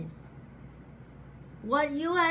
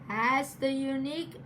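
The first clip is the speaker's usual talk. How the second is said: ordinary talk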